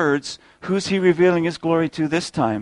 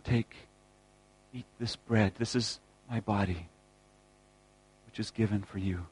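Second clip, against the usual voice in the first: much softer, tender words at the table; reverent hush; 3 semitones lower, 7 dB quieter than usual. Acted. Jesus speaking to his disciples at supper, hushed and tender. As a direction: say it gently, as bread is broken.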